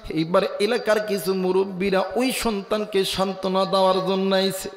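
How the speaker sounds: ordinary delivery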